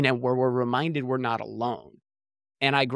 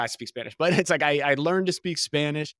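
usual speaking voice

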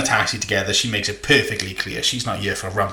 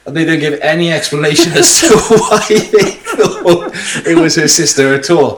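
laughing; 7 semitones higher, 8 dB louder than usual. Real, not acted. Laughing while talking.

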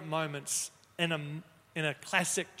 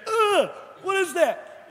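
much higher, slow, louder startled cry; startled kids; 12 semitones higher, 8 dB louder, slower than usual. Retold or imitated. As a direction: mimic kids recoiling in shock. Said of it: "twenty kids go".

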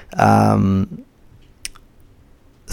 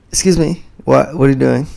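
irritated American; 5 semitones higher, 4 dB louder than usual. Retold or imitated. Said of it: "they'll stop talking".

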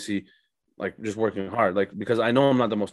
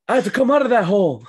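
Goggins impression; 13 semitones higher, 8 dB louder than usual. Imitating Goggins audiobook voice.

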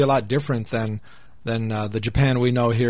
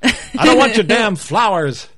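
put-on loud, high cranky old man yell; grumpy old man; 9 semitones higher, 6 dB louder than usual. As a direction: shout like a crotchety old neighbor.

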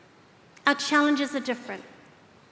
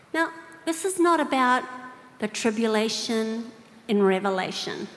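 ordinary talk, nothing marked

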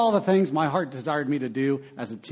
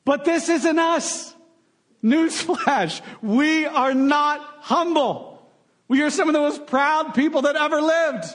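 mock proud swagger; 9 semitones higher than usual. Preacher's ironic mocking outburst.

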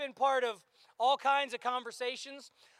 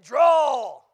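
showdown command; 11 dB louder than usual. Acted.

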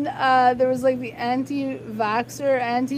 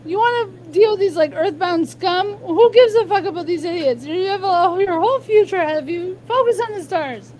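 raised, insistent retold plea; higher, louder, pressing reassurance; urgent pep talk; 6 semitones higher, 5 dB louder than usual. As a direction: talk a friend down, forcefully. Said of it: "I was like".

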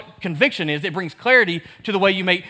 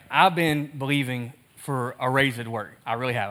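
retelling Thomas; 7 semitones lower, 5 dB quieter than usual. Retold or imitated. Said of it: "He's like".

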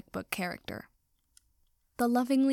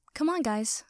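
ordinary delivery, nothing marked

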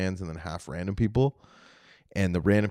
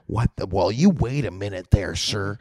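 louder, indignant audience-member imitation; mock offended; 6 dB louder than usual. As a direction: play affronted at the comedian.

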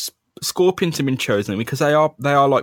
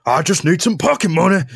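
high, louder villain whine; Scorpion voice; 7 semitones higher, 4 dB louder than usual. Acted. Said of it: "six different voices for the Scorpion".

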